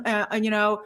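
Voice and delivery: ordinary delivery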